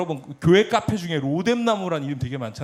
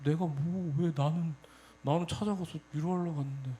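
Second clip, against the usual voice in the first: inner grumbling; 6 dB quieter than usual. Retold or imitated. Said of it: "속으로".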